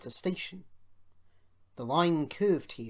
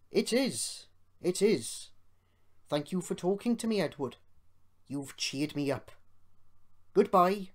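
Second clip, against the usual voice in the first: friendly engines' farewell; 5 semitones higher, 5 dB louder than usual. A narrator's reading.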